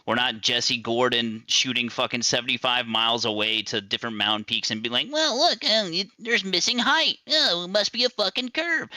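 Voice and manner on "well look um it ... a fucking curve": mocking voice. Mocking Jesse Gordon's claim.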